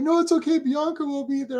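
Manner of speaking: high-pitched imitation of a friend consoling; imitated consoling. Acting out Steve consoling him.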